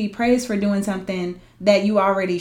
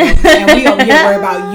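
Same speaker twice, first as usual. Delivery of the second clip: louder, laughing through the words; laughing exasperation; 10 dB louder than usual. Real, not acted. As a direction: laugh at how absurd it is.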